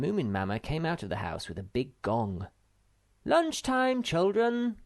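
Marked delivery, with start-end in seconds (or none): 3.2-4.8 much higher, louder sing-song call; calling the children in to lunch. A narrator's reading. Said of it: "she cried".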